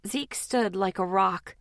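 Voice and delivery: ordinary delivery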